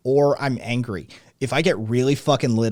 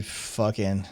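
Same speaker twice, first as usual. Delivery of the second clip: ordinary talk, nothing marked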